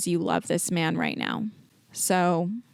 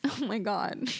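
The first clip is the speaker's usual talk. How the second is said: teary laugh; 2 semitones higher, 6 dB quieter than usual. Real, not acted. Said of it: "I'm so emotional".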